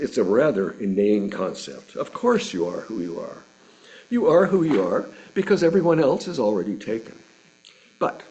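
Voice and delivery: ordinary talk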